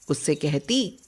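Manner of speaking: usual speaking voice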